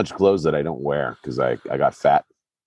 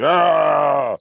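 high mock-menacing yell; sudden hammed-up cry; comic yell; 8 semitones higher, 4 dB louder than usual. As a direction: holler like someone giving chase. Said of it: "fucking Pakistani dude".

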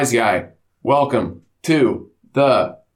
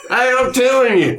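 improv of his dad; 9 semitones higher than usual. Retold or imitated. Host imitating his drunk dad at a cohost, high laughing holler. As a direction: holler it like a tipsy country father. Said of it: "improv of my dad, Walt".

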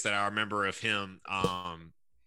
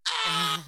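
imitated high, loud scream; freak-out scream; 6 dB louder than usual. Host mimicking a caller hearing the hold music, high mock scream. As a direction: mimic a sudden piercing yell of outrage. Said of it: "And he starts freaking out".

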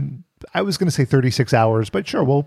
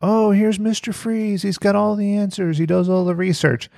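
mock listener voice; 6 semitones higher than usual. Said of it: "listeners expect".

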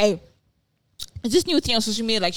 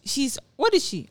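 normal talking voice

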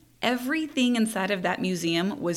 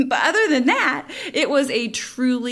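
laughing; 6 semitones higher, 5 dB louder than usual. Real, not acted. Laughing at the wedgie story.

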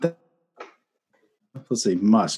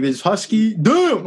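Fluffy; 8 semitones higher, slower than usual. Retold or imitated. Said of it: "the Fluffy bit where he's like".

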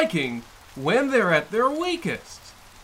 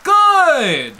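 higher, louder burst of glee; eager exclamation; 8 semitones higher, 8 dB louder than usual. Acted. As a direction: pounce on it gleefully. Delighted he stays.

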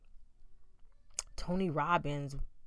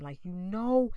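ordinary talk, nothing marked

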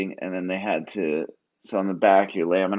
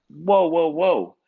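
high, louder burst of protest; retold alarm; 9 semitones higher, 4 dB louder, slower than usual. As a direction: stop them short, pitch jumping up. Patient halting anesthesiologist in alarm.

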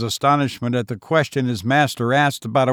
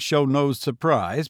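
plain reading voice

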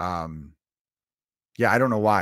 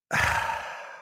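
long breathy sigh of relief; satisfied sigh; 4 dB quieter than usual. Retold or imitated. Savoring the first beer at the bar, contented sigh. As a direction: exhale like the first sip hit. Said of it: "you're just like".

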